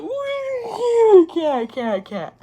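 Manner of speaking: very high, drawn-out squeamish squeal; acted-out cringing. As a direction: squirm through it in a squealing pitch. Retells cringing at baby talk.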